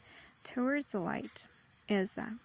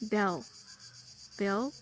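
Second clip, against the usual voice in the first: louder, announced model word; called-out example word; 3 dB louder than usual. Shown as a demonstration. Teacher announcing a vocabulary word to students, louder. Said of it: "bill in British English".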